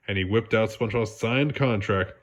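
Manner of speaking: plain reading voice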